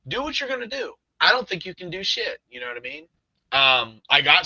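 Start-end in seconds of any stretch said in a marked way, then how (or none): 0.1-2.4 higher-pitched taunt; retold cocky dare; daring. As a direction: goad it, pitched up without raising the volume. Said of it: "I'm still basically daring it".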